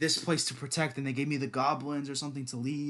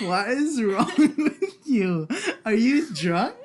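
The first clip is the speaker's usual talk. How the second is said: higher, louder, slow, laughing; laughing disbelief; 9 semitones higher, 8 dB louder, much slower than usual. Real, not acted. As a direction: laugh at her like she's lost it. Laughing at friend's silliness.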